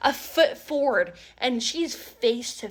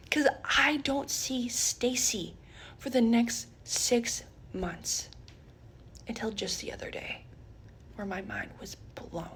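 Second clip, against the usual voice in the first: a softer, unvoiced teaser; whisper; 7 dB quieter than usual. Real, not acted. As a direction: whisper the suspense. Whispered suspense.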